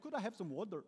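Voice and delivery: playfully imitated Wakanda accent; amateur Wakanda accent, put on for fun; bad Wakanda accent. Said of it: "That's like a bad Wakanda accent".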